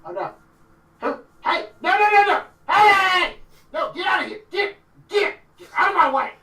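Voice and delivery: high, loud yells of a creature barging through; frantic acted shouting. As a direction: shout them out of your way, high-pitched. Distant commotion acted by game master.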